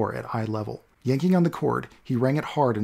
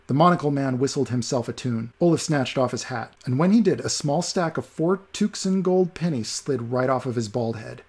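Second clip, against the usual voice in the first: ordinary delivery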